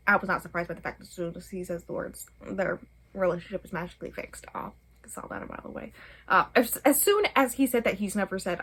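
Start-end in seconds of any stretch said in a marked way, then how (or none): 4.5-5.9 quick dry whisper, breath only; whispered aside. Real, not acted. Vlogger smugly noting a predictable ending, quick whisper. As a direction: mutter it under your breath, smug.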